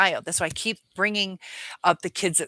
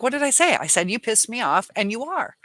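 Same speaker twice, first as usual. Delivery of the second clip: mock indignant; 5 semitones higher, 4 dB louder than usual. Voicing defensive narcissist.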